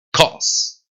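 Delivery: "Cause" as kos, firm k; "Cause" as one English syllable; English hard C. Shown as a demonstration. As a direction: model "Cause" alone with a long final s. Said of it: "causa en inglés se dice cause".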